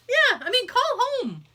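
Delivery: ordinary delivery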